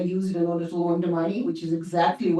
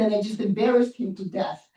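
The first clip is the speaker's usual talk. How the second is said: normal talking voice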